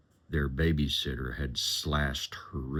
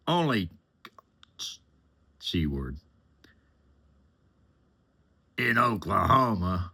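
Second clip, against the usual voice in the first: higher, louder scornful mocking; contemptuous sneer; 7 semitones higher, 4 dB louder than usual. Passenger sneering about the girl's hometown in Oklahoma, loud contempt. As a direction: spit out the town name with disgust.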